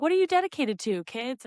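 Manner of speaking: put-on quick, high teacher-to-kids voice; chirpy prompt to little campers; addressing kids. Instructor addressing class, retold.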